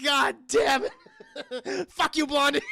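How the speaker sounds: high, drawn-out angry yell with laughter; laughing imitation of a furious holler; mock outrage. Tuco furious at bedpan prank.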